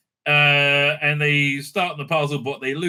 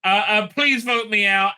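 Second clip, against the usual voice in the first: mock pleading Hannah; 6 semitones higher, 4 dB louder, slower than usual. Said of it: "Hannah says".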